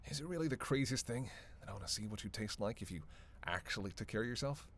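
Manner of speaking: ordinary talk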